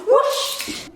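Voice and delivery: whispered whoosh effect; breathy whoosh like wind; whoosh sound. Pretend. Sound effect while moving off.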